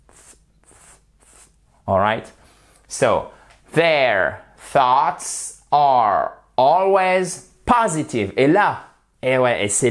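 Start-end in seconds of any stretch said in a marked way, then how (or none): 0.1-1.0 soft unvoiced TH hiss. Shown as a demonstration. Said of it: "C'est vraiment un". 3.7-8.3 slow careful English; very slow model reading of "their thoughts are always positive"; model sentence. Shown as a demonstration. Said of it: "c'est vraiment un son ténu".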